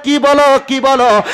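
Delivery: loud, high startled question; astonished exclamation. Acted. Prophet hears the jinn's news, loud astonished exclamation. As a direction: burst out loudly, twice, in disbelief.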